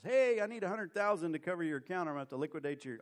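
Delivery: mock-urgent plea on the phone; urgent margin call. Broker phoning clients for margin money, urgent.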